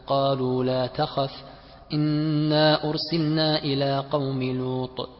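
slow chant with long elongations; Quranic recitation. Shown as a demonstration. Student reads a verse example in class, Quranic recitation. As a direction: recite as tajweed, holding the vowels.

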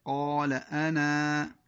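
أنا stopped with the final alif drawn long; alif kept. Shown as a demonstration. Teacher shows keeping the alif at the stop. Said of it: "الوقف على أنا ولكن بإثبات الألف".

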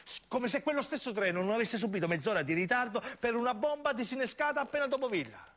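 ordinary talk

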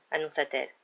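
usual speaking voice

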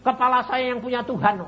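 high, loud retort; acting out his own fierce comeback; retold defiant yell. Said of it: "aku ya teriak gitu".

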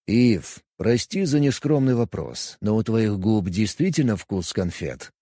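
cool, calm alien; Vulcan voice. Acted. Vulcan Kos asks.

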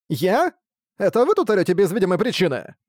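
indignant little voice, stung by blame; hurt, wronged child. A narrator's reading. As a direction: protest like an unfairly scolded child. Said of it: "обиженным голосом несправедливо выруганного ребёнка".

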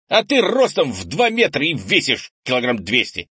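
put-on high, loud child's retort; indignant boy. A narrator's reading. Boy argues about grandfather's weight, indignant. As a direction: throw the protest back, outraged. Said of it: "в ответ возмутился Кенни".

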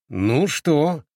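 high, pleased question; self-satisfied. A narrator's reading. Boy shows off the successful cure, self-satisfied. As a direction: ask it as if proven right. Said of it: "с довольным видом сказал Симэнь Хуань".

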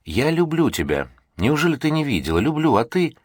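anguished shouting. A narrator's reading. Felix repeats his confession, building into an anguished cry. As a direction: let the strain and hurt break through. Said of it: "в этом крике слышалось накопившееся напряжение".

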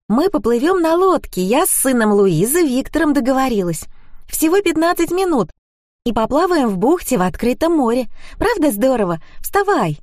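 high-pitched, excited friend rousing someone; perky Zhenya, enthusiastic. A narrator's reading. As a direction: sell the outing with bubbly insistence. Narrator voices tipsy Zhenya.

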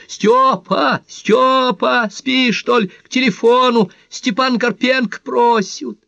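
very high, loud calling out; piercing summons to the phone; full-throated yelling. A narrator's reading. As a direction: bawl for someone in another room. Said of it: "И заорала во всё горло".

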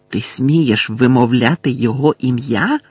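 ordinary delivery

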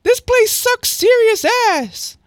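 very high, loud squeaky complaint; drawn-out falsetto whine, far above your own voice; C C's voice. Acted. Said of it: "I forget what C C's voice is".

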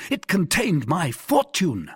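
high, slow, emphatic lament; drawn-out, high exclamation; crying out. A narrator's reading. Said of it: "cried the Gascon".

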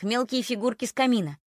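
ordinary delivery, nothing marked

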